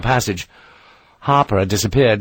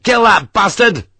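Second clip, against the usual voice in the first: fierce, much higher, louder command; angry order; 10 semitones higher, 4 dB louder than usual. Acted. Sharp orders Hagman to shoot the sergeant, angry. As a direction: spit out the order to kill.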